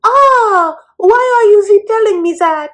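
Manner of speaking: squealed high and loud, mock whine; hammed-up indignant squeal; whiny high Amira. Amira protesting to her dad, mock high whine. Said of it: "And Amira's like".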